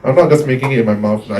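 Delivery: proximity effect, deep and full; close-mic bassy voice. Shown as a demonstration. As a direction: lean into the microphone so the bass swells. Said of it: "the bassier I get".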